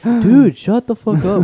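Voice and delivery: high, loud mock protest; retold annoyed yell. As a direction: imitate a teased classmate yelling back. Retold reply to being teased.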